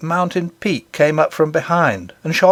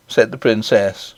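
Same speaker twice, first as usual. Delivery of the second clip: ordinary delivery, nothing marked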